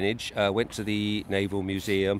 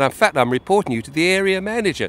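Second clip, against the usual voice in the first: high, louder mock official threat; threatening inspector; 9 semitones higher, 6 dB louder than usual. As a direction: puff up like a petty inspector. Inspector threatens to report her.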